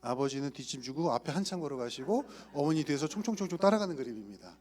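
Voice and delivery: normal talking voice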